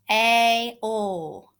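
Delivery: HALE broken into H, long A, then L; A-L pulled apart slowly, sound by sound. Shown as a demonstration. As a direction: stretch the A and L as separate sounds. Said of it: "stretch, and sound out these words".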